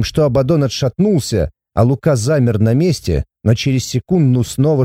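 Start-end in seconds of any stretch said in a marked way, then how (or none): none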